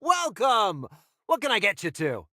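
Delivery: bright, loud, high barkeep's hello; cheery welcome. Acted. Barkeep calling out to two arriving customers, loud and cheery. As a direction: call out the welcome to new customers.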